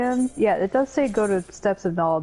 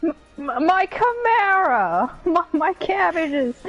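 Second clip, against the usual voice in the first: mock anguished cry; 7 semitones higher than usual. Mock distress for character.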